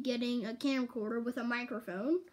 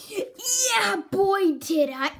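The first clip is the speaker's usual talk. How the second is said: mock emphatic; 6 semitones higher, 7 dB louder, much slower than usual. Mock emphatic self-remark.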